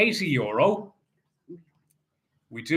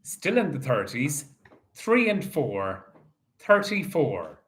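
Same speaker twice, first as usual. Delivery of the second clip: bingo caller. Acted. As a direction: call each ball out steadily. Calls bingo numbers.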